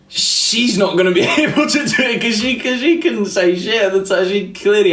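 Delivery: high-pitched, breaking up with laughter; laughing. Real, not acted. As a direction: try to finish the sentence while laughing. Laughing at own awkward story.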